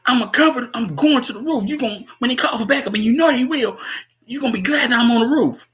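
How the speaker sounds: high-pitched imitation of pleading justification; mock defensive excuse. O'Neill explaining his dash to the roof, mock defensive. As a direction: pitch it up, hurriedly talking your way out.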